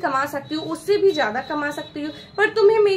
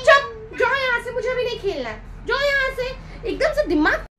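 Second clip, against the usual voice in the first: put-on raised, higher voice snapping at the kid; angry scolding; 7 semitones higher than usual. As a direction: tell the child off, fed up after work. Tired mother scolds her child. (थकी माँ बच्ची को डाँटती, ऊँची गुस्से वाली आवाज़)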